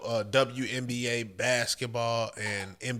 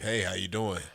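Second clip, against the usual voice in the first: lower, deep smooth greeting; 6 semitones lower than usual. Shown as a demonstration. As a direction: drop into a low octave. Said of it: "go into that lower octave".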